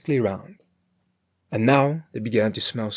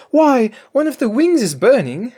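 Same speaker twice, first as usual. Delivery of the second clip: much higher, louder mock alarm; alarmed cry; 13 semitones higher, 10 dB louder than usual. A narrator's reading. Cook pretending alarm at the burning wing, higher and louder. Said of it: "she cried presently".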